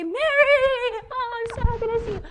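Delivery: high, fast, excited squeal of hello; gushing greeting. Acted. Preacher mimics the greeting Elizabeth didn't give, high gushing squeal. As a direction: gush it out, high-pitched and rushed.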